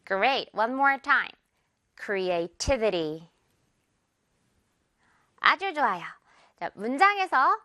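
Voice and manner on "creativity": creativity very slowly, the stress on the TI syllable; slow model word, cre-a-TI-vi-ty. Shown as a demonstration. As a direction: stretch out creativity and punch the TI. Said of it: "T 부분에 강세 주세요".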